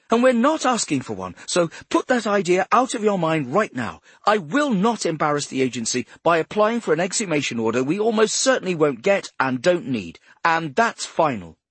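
high, loud, final orders; angry shouting. Acted. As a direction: shout her final word. Boss lays down the law.

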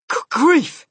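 high, loud cry of alarm; shocked cry. A narrator's reading. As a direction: exclaim in horrified discovery. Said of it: "he cried".

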